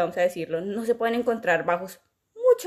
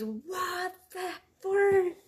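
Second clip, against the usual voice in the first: high, soft disbelieving "what the"; stunned reaction; 10 semitones higher, 5 dB quieter, much slower than usual. Retold or imitated. Fan reacting to the song, high stunned disbelief. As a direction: caught off guard by the sound drop. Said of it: "y dices".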